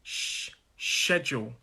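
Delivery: a long "sh", then "schedule" as SHED-jool; slow demonstration of sh opening "schedule"; sh sound isolated. Shown as a demonstration. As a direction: hold the sh alone, then say "schedule" slowly. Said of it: "has a sh noise".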